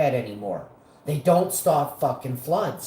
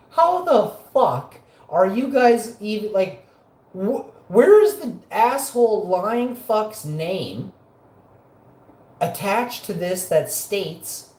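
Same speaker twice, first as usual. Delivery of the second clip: angry disbelief; 6 semitones higher, 5 dB louder, slower than usual. Retold or imitated. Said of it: "I'm like".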